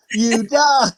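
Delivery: ordinary delivery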